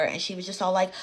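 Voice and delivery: ordinary talk, nothing marked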